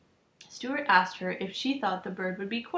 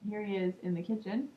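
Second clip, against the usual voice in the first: ordinary delivery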